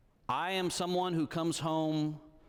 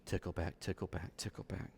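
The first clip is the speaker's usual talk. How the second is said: softer and softer, lower; fading to hush; 8 semitones lower, 8 dB quieter than usual. Real, not acted. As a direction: let each tickle back fade away. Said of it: "soothing narration fading".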